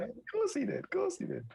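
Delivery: high mock-sarcastic "of course he did". Real, not acted. Teasing her co-host with high sarcastic agreement. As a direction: drip sarcasm, pitch way up.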